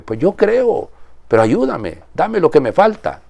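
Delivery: high, imploring cry of a desperate father; pleading father. Retold or imitated. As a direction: beg like a father for his son. Father's plea retold emotionally.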